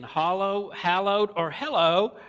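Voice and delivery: hollow, hallowed, hello set apart loudly by their vowels; look-alike words contrasted. Retold or imitated. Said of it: "with your southern accent".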